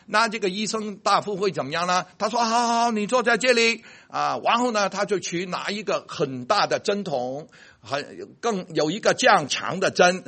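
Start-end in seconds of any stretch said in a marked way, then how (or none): 2.5-3.8 higher, humouring reassurance; calm the patient down lightly; soothing doctor. Acted. Doctor settling a frightened patient, lightly voiced.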